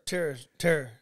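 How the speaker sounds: "Terror" said as a single syllable, like "tair"; Bush impression. Co-host tries Bush's "Terror", each in one syllable. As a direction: drop the second syllable of "Terror", Bush-style. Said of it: "one syllable".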